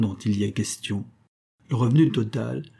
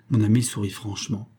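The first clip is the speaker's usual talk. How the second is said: usual speaking voice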